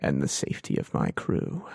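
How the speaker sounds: very low, soft whispered purr on "And the safety of my crew.". Acted. Thrawn answers Chafra in a low whisper purr. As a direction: purr it low and almost breathless. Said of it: "he's at peak whisper purr".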